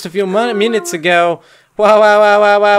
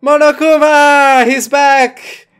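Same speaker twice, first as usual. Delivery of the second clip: loud, high cry of excitement; excited shout; 8 semitones higher, 5 dB louder, slower than usual. Real, not acted. Player greets Monokuma's return, excited shout.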